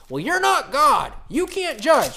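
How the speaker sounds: high, loud, drawn-out mock protest; exaggerated, high-pitched mimicry of a scolding critic; mocking objector. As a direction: shout it like an indignant objector.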